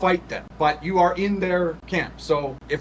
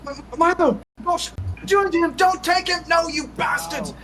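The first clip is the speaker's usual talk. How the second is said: very high-pitched desperate cry; frantic mother's yell, not full volume; acted distraught yell; 13 semitones higher than usual. A narrator's reading. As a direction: plead and protest in a strained high voice. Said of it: "As she's yelling".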